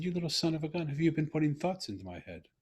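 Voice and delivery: put-on a fond tease of a clever child; playful amazement. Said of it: "And I said".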